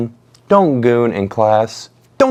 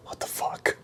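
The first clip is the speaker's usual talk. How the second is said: breathy whisper of an exhausted teacher; under her breath; 16 dB quieter than usual. Retold or imitated. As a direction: whisper it, head on the desk. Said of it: "I hear her under her breath go".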